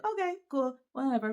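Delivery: high, bright sarcastic "okay, cool"; mock meek acceptance. Mother mocking the swim school's expected reply, sarcastic and high. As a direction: parrot fake compliance, dripping sarcasm. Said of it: "and be like".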